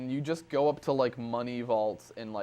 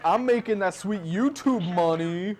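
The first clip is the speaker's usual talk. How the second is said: loud, cocky, higher voice; brash boast; 7 semitones higher, 6 dB louder than usual. Retold or imitated. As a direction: shout it like you're quitting in style. Retold brash quitting school.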